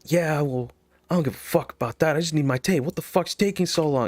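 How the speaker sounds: put-on exasperated rant; getting upset. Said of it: "clearly getting upset".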